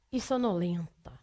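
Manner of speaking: slow, soft and sleepy; drowsy. Acted. Teacher mocking the long rule, sleepily. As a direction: let the words droop as if nodding off.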